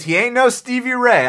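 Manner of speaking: loud emphatic verdict; emphatic declaration. Real, not acted. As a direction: slam the verdict down. Repeats claim with emphasis.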